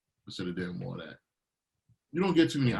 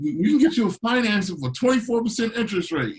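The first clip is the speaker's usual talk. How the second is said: much higher, louder salesman pitch; pushy car salesman; 11 semitones higher, 6 dB louder than usual. Retold or imitated. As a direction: slick dealer pushing a bad loan. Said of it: "Go to a car salesman?".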